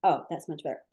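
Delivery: normal talking voice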